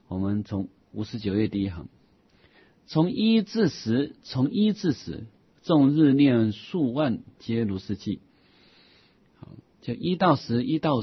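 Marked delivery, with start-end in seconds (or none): 2.9-8.2 slow, measured reading of scripture; measured recitation of an old Buddhist text; formal text recitation. Acted. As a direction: read the classical passage slowly and deliberately. Reads a classical Buddhist text aloud.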